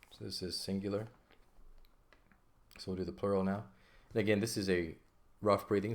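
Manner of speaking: ordinary talk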